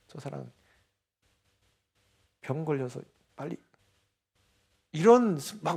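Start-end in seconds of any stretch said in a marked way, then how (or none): 0.1-3.6 muttered curse. Retold or imitated. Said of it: "막 화가 나면".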